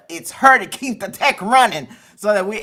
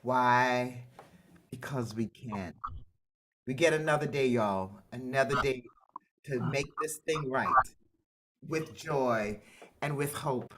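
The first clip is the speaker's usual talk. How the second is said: guided breathing; 9 semitones lower, 9 dB quieter than usual. Acted. Host leads the Zoom group in a calm breathing moment. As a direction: ease everyone into a deep breath.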